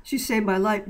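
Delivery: usual speaking voice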